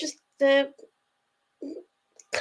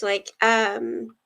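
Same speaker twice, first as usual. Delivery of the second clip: ordinary delivery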